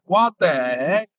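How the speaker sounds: drawn-out, incredulous exclamation; retold amazement. Player recalling the GM flying in to see him, amazed. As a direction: slowly stretch out the disbelief. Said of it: "I'm like".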